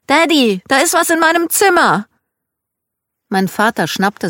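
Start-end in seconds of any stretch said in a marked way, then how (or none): 0.1-2.1 high, loud cry for Daddy; frightened child. A narrator's reading. Scared child fetching Dad to the bedroom, high alarmed cry. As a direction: call out in alarm, voice shooting up. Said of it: "bekam es mit der Angst zu tun".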